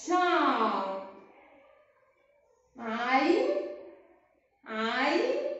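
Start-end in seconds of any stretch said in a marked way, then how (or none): 0.1-1.1 slow model word. Shown as a demonstration. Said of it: "بسرعة نقول مع بعض".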